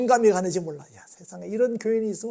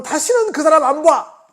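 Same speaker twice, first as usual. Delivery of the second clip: slow furious shout, much higher and louder; preacher mimicking a believer after a fight, angry shout; 10 semitones higher, 12 dB louder, slower than usual. Bellow it, cutting someone off for good. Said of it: "우리가 한 번 싸우면".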